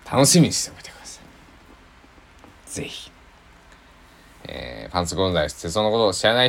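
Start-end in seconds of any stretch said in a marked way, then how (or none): 0.1-3.1 slow teasing whisper, higher; playful whisper. Acted. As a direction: whisper it like a secret. Teasing fans after refusing apology.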